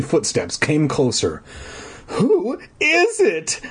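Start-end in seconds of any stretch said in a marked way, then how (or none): 2.1-3.6 high, slow frightened cry; shrill cry. Acted. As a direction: cry out thin and scared. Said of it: "I cried shrilly".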